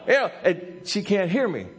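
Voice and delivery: worked-up storytelling at high pitch; excited, high-pitched sermon anecdote; animated preaching. Real, not acted.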